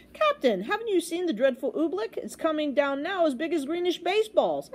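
high-pitched urgent warning; urgent alarm. Acted. Boy warns the captain of oobleck, urgent. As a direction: report it in alarm.

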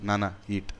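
soft, low, gentle offering; tender child. Retold or imitated. Little son gives father a piece, softly tender. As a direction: hand it over softly like a small child.

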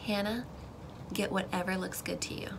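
God's quiet voice to Hannah; still small voice. Retold or imitated. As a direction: speak softly like a quiet inner prompting. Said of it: "that still small voice was like".

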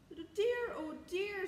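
high, loud worried exclamation; mounting dismay. A narrator's reading. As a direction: fret aloud, pitched well up.

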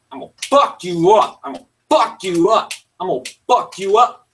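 loud angry threat at the game. Real, not acted. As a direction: yell it at the opponent, furious. Raging at game opponent.

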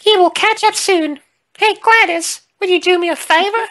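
very high, sing it out in character; high-voiced game character. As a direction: put on a squeaky-high character voice.

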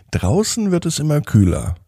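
plain reading voice

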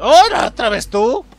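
loud, high outburst; incredulous. Real, not acted. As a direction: exclaim in loud disbelief at a familiar face. Same suspicious man turns up again.

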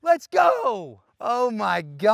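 high-pitched victory cry; elated shouting. Real, not acted. Golfer who just holed out, elated shouting. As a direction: cry out, thrilled and amazed.

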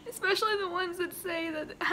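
happy tears, voice lifting; choked-up gratitude through real tears; crying as you thank them; tearful, crying. Real, not acted. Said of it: "why I'm crying".

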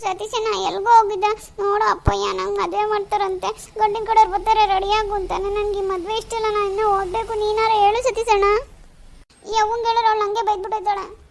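high, loud weepy pleading; tearful plea. Acted. Daughter crying to Satish to stop the wedding. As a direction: beg for help between sobs.

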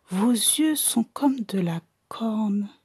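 chuchotement lent; chuchoté. Acted. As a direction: souffler les mots, fasciné. Student whispers in wonder. (l'étudiant chuchote, lentement)

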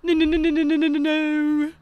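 loud, high rapid-fire British "no"s; British accent. Shown as a demonstration. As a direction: put on a mock British wail of no. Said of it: "say it with a British accent".

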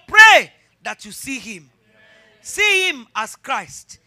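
loud, high, shouting sermon climax; full-force preaching. Real, not acted. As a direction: preach it hard, shout to the hall.

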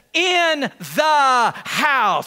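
slow, loud proclamation, word by word; emphatic shout. Acted. Jairus declaring his method to everyone, slow emphatic shout. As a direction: drive each word home at full voice.

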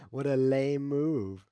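slow, drawn-out, loud disdain; booming, dismissive groan; loud scoffing. Real, not acted. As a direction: stretch it out in loud derision. Scoffs at the opponent's move.

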